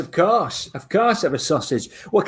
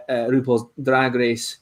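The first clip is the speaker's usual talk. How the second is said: ordinary talk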